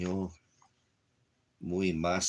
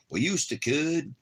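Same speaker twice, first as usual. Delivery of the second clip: mock dramatic; 11 semitones higher, 5 dB louder, slower than usual. Goofy dramatic line to puppy.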